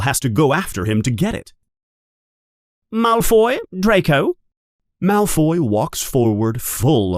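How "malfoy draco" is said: high, ringing name call; teacher calling the first pupil. Acted. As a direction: call the name across the hall.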